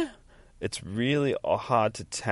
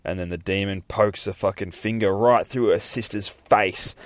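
normal talking voice

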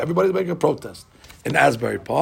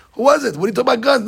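worried wife; 5 semitones higher, 6 dB louder, much faster than usual. Retold or imitated. Wife questions him about phone call.

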